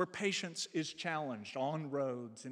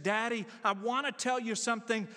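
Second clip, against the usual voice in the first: put-on high, louder little girl's plea to her father; child's urgent appeal, voiced higher; young daughter; 7 semitones higher, 4 dB louder than usual. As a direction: lightly imitate an eager daughter, pitched up. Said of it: "she went to him and she said".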